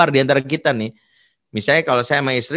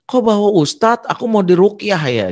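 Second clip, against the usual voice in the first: tinggi dan agak keras, suara anak yang curiga; curiga panik; 8 semitones higher than usual. Retold or imitated. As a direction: kaget curiga seperti remaja. Anak menduga akan diruqyah. (suara anak yang curiga, tinggi)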